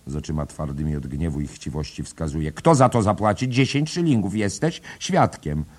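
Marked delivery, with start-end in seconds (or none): 2.5-5.6 loud, high and scolding; angry demand. A narrator's reading. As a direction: jab each word at him. Bella demands ten shillings.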